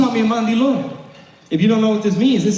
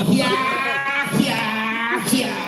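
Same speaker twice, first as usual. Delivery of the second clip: imitated crowd cheers, drawn out; mimicked whooping fans; cheering crowd; 6 semitones higher, much slower than usual. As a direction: cheer like a delighted audience. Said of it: "They were loving it".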